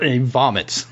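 ordinary talk, nothing marked

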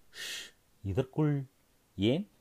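sharp, secretive hush. Acted. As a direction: shush him before anyone hears. Hushing a premature cheer.

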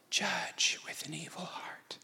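slow, intense whisper; whispered rebuke. Retold or imitated. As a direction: drop suddenly to a drawn-out whisper. Acting out God's rebuke.

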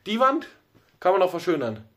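Wand jumps up high; stress on Wand. Shown as a demonstration. Showing a friend how stress shifts meaning, Wand stressed high. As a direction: hit the word Wand, then carry on. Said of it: "da kannst du viel mit der Betonung arbeiten".

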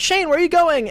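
high calling-out voice; calling teacher. Retold or imitated. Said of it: "the teacher's like".

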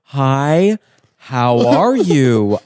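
very slow, drawn-out greeting; exaggerated careful slowness; talking to the elderly. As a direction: stretch each word as if for a frail listener. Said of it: "I've met elderly people as well".

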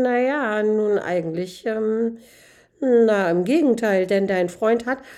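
stall, then push on with the gossip; flustered, evasive stammering admission; squirming. Acted. Man squirms, admits the opposite.

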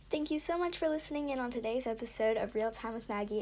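soft host sign-off read; podcast outro. Acted. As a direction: close the show like a host.

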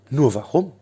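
ordinary delivery, nothing marked

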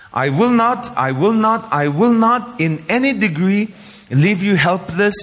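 high, forceful "I will not", each time over; emphatic repetition. Shown as a demonstration. Preacher voicing God's promise from the Bible, emphatic. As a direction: drive home "I will not", pitched well up. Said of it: "The emphasis is mentioned here".

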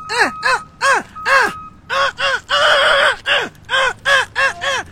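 rapid, high punching cries; short rhythmic yelps thrown with each punch; shadow-boxing yelps. Real, not acted. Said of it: "shadow boxed like that".

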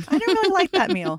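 high-pitched imitation of a grumbling husband; mocking a belated complaint, high-voiced.

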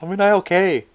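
loud, high call into a phone. Retold or imitated. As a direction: shout across the store on a phone. Lady calling out on her phone.

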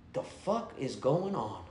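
dazed mumble. Retold or imitated. Waking up dazed after seizure.

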